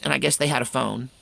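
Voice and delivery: usual speaking voice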